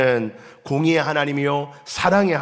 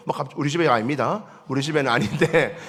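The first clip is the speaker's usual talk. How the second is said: normal talking voice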